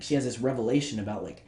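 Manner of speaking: usual speaking voice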